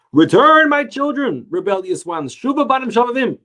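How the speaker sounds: loud, high booming proclamation; heavenly voice proclaiming. Retold or imitated. Rabbi voicing the heavenly voice calling sinners back, loud and high. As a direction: call out like a voice from heaven.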